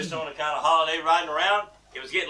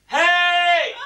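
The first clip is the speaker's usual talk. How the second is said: loud, drawn-out holler; crying out for help; 11 semitones higher, 7 dB louder than usual. Acted. Man hanging from a cliff, hollering upward. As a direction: holler it high into the distance. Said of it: "he cried out for help".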